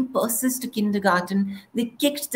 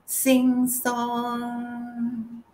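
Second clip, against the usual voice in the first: "sing song" lifted higher with a lilting, musical swing; 5 semitones higher than usual. Shown as a demonstration. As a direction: make "sing song" sing and bounce upward. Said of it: "It's all about resonance".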